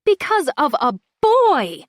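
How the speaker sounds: put-on boy hit high and loud; stress on boy. A narrator's reading. Friend accuses old friend, stressing boy. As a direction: punch the word boy in teenage outrage. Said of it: "as I stress the word".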